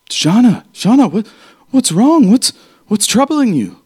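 high-pitched nervous admirer pleading; worried Tobin. Acted. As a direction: fret over her like a flustered suitor. GM voices Tobin's worried question.

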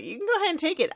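high, airy dismissive voice; haughty dismissal. Acted. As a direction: wave it away in a high voice. Trixie haughtily giving up amulet.

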